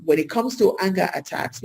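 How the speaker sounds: ordinary delivery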